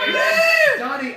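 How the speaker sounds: high squeal of laughter; laughing. Real, not acted. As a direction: burst out laughing, slow. Laughing reply to Donnie.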